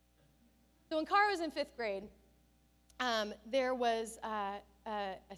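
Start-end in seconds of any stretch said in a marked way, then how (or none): 0.1-0.9 put-on whispered teen yeah. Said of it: "she's like".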